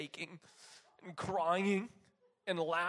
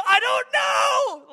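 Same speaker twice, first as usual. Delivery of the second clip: high, very loud, drawn-out hysterical cry; re-enacted hysterical laughing yell; laughing shout; 16 dB louder, much slower than usual. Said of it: "I was just laughing".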